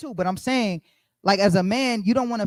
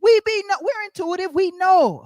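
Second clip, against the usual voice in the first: much higher, louder mock knowing tone; 11 semitones higher, 4 dB louder than usual. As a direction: pitch it way up, playful and knowing. Preacher in high put-on voice.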